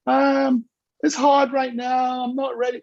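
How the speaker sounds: high, loud whiny excuse; hesitant angel. Retold or imitated. Speaker mimics an angel making excuses to Nigel, high and loud. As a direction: hem and haw like you're not ready. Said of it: "and they".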